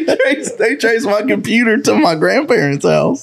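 high laughing telling. Real, not acted. Telling friends about the FBI trace, laughing. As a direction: push through the laughter.

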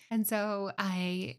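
ordinary talk, nothing marked

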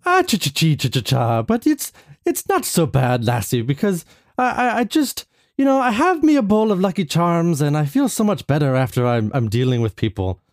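hammed-up lilting Irish accent. Irish patient reassures therapist, broad mock Irish accent. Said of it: "The Irish accent".

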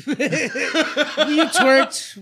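high, laughing accusation; laughing tease. Real, not acted. Friend teasing about twerking, laughing.

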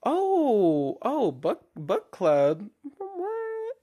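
hammed-up fake surprise, squeaking up high; feigned startled confusion; mock surprise. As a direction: play dumb, voice jumping way up on What. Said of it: "I was like".

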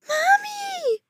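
put-on extremely high little-boy wail; crying, screaming child. A narrator's reading. Frightened boy calling his mother, screaming. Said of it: "West was crying, screaming".

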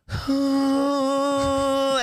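long, high held "ooh"; mock singer holding one high note; sung warm-up note. Guitar girl warming up her voice, long high note. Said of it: "she's, like, warming her voice up".

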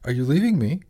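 scared, rising in pitch and volume; suddenly scared. A narrator's reading. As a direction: blurt it out in sudden fear. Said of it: "suddenly scared".

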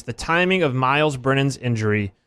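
"timing" leaned on a little; stress on timing. Shown as a demonstration. Host reads the question, stressing "timing". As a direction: press gently on "timing". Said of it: "And I emphasize the word timing".

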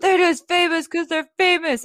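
high, sneering mimic of a commenter; mocking whiny voice. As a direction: parrot the haters with a sneer.

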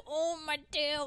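high, slow, whiny snake. Retold or imitated. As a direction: whine it like a hurt snake. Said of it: "he's like".

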